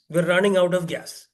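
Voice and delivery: ordinary talk, nothing marked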